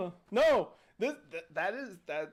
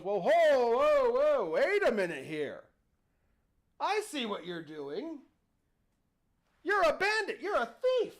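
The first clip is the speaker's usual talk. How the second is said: louder, higher "whoa, whoa" and then a high accusation; old man protesting; 4 semitones higher, 3 dB louder than usual. Acted. Old man to the genie, loudly calling him a thief. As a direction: play the old man crying foul at a bandit.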